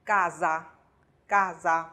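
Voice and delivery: casa said as KA-za; casa with voiced s between vowels. Shown as a demonstration. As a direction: model casa slowly with a buzzed z. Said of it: "所以这里面的s发z的音".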